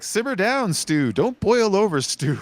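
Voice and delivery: loud, high, hammed-up scolding; mock scolding. Show characters calming the angry dad, loud mock scolding. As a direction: tell him off like a sitcom mother. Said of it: "they'd say stuff like".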